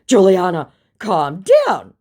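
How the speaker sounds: loud, high mock appeal; mock placating. Impostor trying to placate the woman, mock soothing. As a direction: act out his attempt to placate. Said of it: "trying to placate me".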